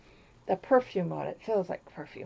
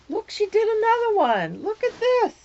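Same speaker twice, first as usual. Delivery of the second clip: excited gasp; 12 semitones higher, 3 dB louder than usual. Real, not acted. Gasping at another gift.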